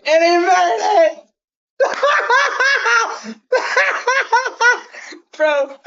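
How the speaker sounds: high screaming laugh of joy; shrieking jackpot winner. Real, not acted. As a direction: shriek like you just won big. Kick made, wild shriek.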